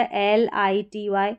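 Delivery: एल आई टी वाई spelled out slowly, letter by letter; slow spelling. Shown as a demonstration. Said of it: "teacher spells letters slowly". (धीरे-धीरे अक्षर बोलना)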